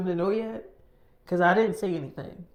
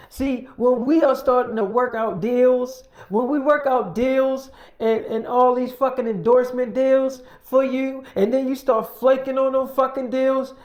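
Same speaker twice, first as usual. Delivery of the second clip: loud, raised-pitch acted telling-off; angry scolding; 7 semitones higher, 7 dB louder than usual. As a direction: lay into her as the fed-up team. Team scolds her over flaking.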